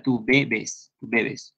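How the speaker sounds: "bebes" softly stressed on the first syllable, BE-bes. Shown as a demonstration. Teacher contrasting Peruvian form with Argentine, "bebes" stressed on BE. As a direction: lean on BE in "bebes", kept soft. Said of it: "sino va en la primera".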